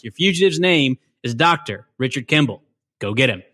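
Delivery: loud, fast commanding briefing; Tommy Lee Jones impression. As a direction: bark orders to the whole squad. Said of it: "I was giving it my all".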